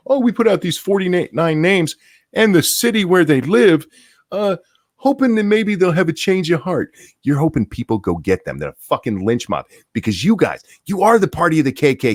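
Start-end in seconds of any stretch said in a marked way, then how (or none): 0.1-6.9 hammed-up high, sing-song innocence; mock innocent excuse. Mocking Democrats feigning innocence about the list, high mock-innocent voice. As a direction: play it falsely innocent and much higher. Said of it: "Don't pretend that you're like".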